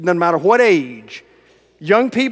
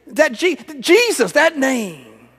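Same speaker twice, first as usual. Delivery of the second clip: higher, lightly voiced child saying the name; little girl's voice; 7 semitones higher, slower than usual. Girl telling the pastor which name, in a higher child voice. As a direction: let the child reach for the name.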